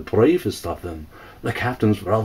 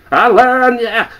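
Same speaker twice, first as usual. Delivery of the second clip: high, loud squawking cackle; a mocking parrot screeching the threat; cackling bird; 10 semitones higher, 7 dB louder than usual. A narrator's reading.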